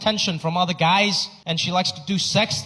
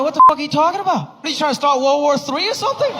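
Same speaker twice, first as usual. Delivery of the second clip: loud, fast, higher mock outrage; indignant outburst; 9 semitones higher, 5 dB louder, faster than usual. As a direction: snap back offended. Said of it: "I'm like".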